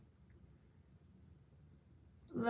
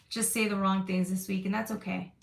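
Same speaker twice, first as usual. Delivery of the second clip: normal talking voice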